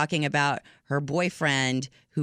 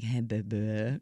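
put-on lower, softer, intimate "Hey, baby."; 8 semitones lower, 6 dB quieter, slower than usual. Shown as a demonstration. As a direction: purr "Hey, baby." low and soft to a lover. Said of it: "I say it differently".

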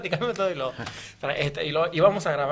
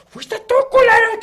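hammed-up angry yell, much higher and louder, slowed; furious fighter; 11 dB louder than usual. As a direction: bellow the accusation like a raging brawler. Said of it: "va llegando y ve ... y lo".

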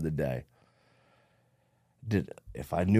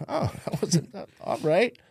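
surprised laughing; 11 semitones higher than usual. Retold or imitated. Waking up after heroin night.

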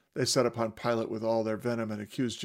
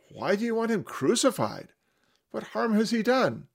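much higher, louder baffled plea; Pilate confused; 11 semitones higher, 5 dB louder than usual. Acted. Pilate confused, asking the crowd why. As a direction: ask the crowd, bewildered. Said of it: "he asks them".

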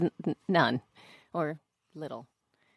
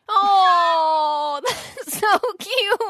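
long, high, drawn-out aww; cooing sympathy; 9 semitones higher, much slower than usual. Real, not acted. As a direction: coo over something adorable. Listener coos at the child story.

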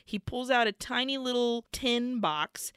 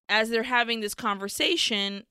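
normal talking voice

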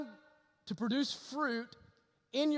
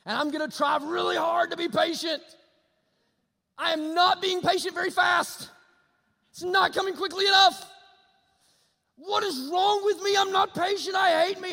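louder, higher, exasperated at himself; frustrated self-scolding; 10 semitones higher, 10 dB louder than usual. Pretend. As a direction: berate yourself for failing. Believer scolding himself, imagined.

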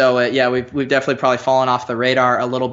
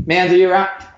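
calling out; 7 semitones higher, 5 dB louder than usual. Acted. Calling for someone off mic.

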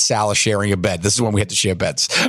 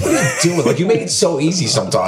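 laughing mockery; 6 semitones higher, faster than usual. Friends mocking his purchase.

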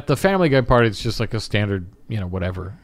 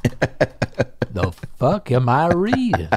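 usual speaking voice

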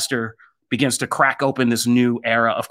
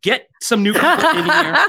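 much higher, hammed-up outrage; mock fed-up demand; 10 semitones higher than usual. Imagined Potter actors ordering the studio around, mock outraged. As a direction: squeal a theatrical demand. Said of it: "and they were like".

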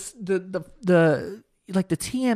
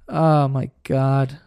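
ordinary talk, nothing marked

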